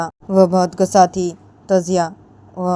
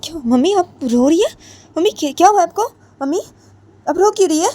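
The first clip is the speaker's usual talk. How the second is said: high, anxious questions; worried daughter-in-law; 11 semitones higher, 3 dB louder than usual. Acted. Ritu asking her crying mother-in-law why she weeps, anxious and high. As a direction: ask what's wrong, alarmed. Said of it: "Ritu की mummy बोलती है".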